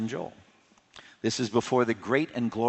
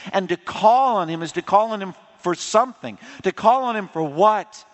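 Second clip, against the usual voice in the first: higher and louder, driving each phrase home; preaching at full force; 7 semitones higher, 5 dB louder than usual. Acted. Preacher urging the congregation to call on Jesus, ringing high and loud. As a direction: build the sermon to a ringing climax.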